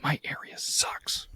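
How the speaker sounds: slow unvoiced whisper aside; whispered gripe. Real, not acted. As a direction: breathe it out slow like a confession.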